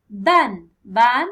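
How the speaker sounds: Ban called out loud and clear; loud model word. Shown as a demonstration. Teacher showing pupils one syllable, loud clear Ban. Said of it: "teacher models the word for counting syllables".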